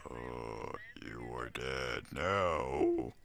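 very low, drawn-out menace; sinister threat. Pretend. Killer to his victim in game, sinister low voice. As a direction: drop deep and stretch each word out slowly.

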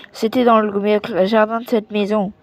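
ordinary delivery, nothing marked

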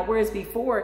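ordinary delivery

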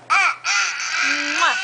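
exaggerated smacking "mwah"; playful kiss noise; blown kiss. Can you blow mommy a kiss.

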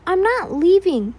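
obsessed friend refusing to leave; retorting. A narrator's reading. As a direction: fire it back at her. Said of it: "he retorted".

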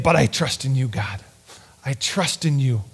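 low, soft, hushed confession; cling to God in a near whisper; quiet trust. Retold or imitated. David hanging on, softly declaring trust.

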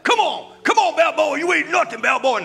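high, loud taunting shout; Mister T taunt. Retold or imitated. Preacher playing Mister T taunting Balboa, shouting. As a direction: jeer at the boxer. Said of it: "Mister T's like".

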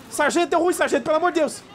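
high, frantic calls for the sergeant; hammed-up panic, begging for help; mock panic. Out of ammo, calling the sergeant in mock panic, high. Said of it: "O Elder em pânico".

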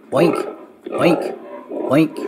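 imitated pig oinks, rising. Narrator voices the piggies oinking. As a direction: grunt like little piggies.

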